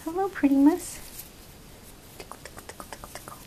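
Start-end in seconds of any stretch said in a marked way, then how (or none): 2.2-3.4 whispering. Real, not acted. Whispered tickling.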